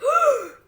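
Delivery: big high surprised gasp; mock gasp. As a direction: gasp loudly in mock shock. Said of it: "people will be like".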